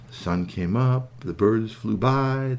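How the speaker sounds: ordinary delivery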